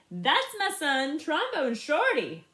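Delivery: proud mother calling out; proud mom. Acted. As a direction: show him off to the whole crowd. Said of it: "held me up in the air and said".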